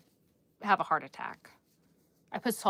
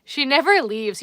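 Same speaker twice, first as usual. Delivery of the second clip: ordinary delivery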